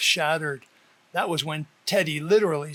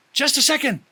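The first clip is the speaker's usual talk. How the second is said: higher, louder blurt; 8 semitones higher, 5 dB louder, faster than usual. A narrator's reading. Uncle stops the girls leaving, higher and louder blurt. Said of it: "I blurt out".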